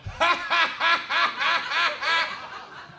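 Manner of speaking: high, loud staccato "ha ha"; mocking cackle. Twins point at the panicking comic, mocking cackle. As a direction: imitate jeering, pointed laughter. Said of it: "both point at me and just start going".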